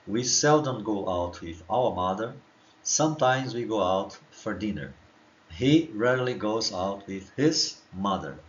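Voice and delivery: slow, careful English example sentences; slow model sentences. Shown as a demonstration. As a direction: read each example slowly for students to copy. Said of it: "Então vamos lá, mais uma vez". (leitura lenta e pausada das frases)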